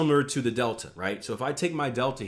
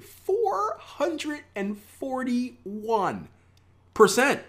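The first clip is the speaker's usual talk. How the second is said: very slow, high, stretched-out wonder; amazed voice; 12 semitones higher, much slower than usual. Shown as a demonstration. Trader urging viewers to say it awed, drawn out high. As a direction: say it like you can't believe it. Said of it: "you gotta do it in that voice too".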